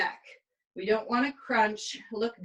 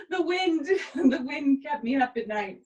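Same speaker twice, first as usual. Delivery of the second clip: tired laughing; 7 semitones higher than usual. Real, not acted. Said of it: "I'm really tired".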